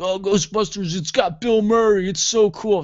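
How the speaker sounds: high mocking fanboy voice. Hipster mocked for loving Bill Murray, high gushing. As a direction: gush like a dopey fan. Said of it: "people are like".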